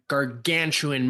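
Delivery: ordinary talk, nothing marked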